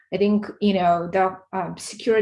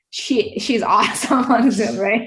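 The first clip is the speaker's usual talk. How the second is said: louder, laughing through the words; 4 semitones higher, 7 dB louder than usual. Real, not acted. Parent telling the panel about a child on Zoom, laughing.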